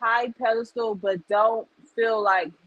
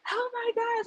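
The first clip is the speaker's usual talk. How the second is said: high, breathless-soft "oh my gosh" gush; mock overexcited girlfriend squeal; ecstatic gush; 13 semitones higher, 5 dB quieter than usual. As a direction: friend gushing over her man, pitched up high. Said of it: "she'd be overly ecstatic about it".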